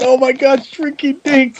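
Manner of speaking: high mocking fan squeal; hyped drunk fan voice. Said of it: "They kept going".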